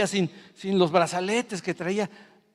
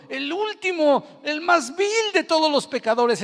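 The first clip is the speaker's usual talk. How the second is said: quoting Paul; 13 semitones higher, 5 dB louder, slower than usual. Retold or imitated. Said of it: "como decía el apóstol Pablo".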